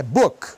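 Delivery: "book" stopping on the k; silent final consonant. Shown as a demonstration. Teacher modelling for Brazilian learners, no vowel after "book". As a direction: end "book" on a bare k. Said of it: "Não tem I".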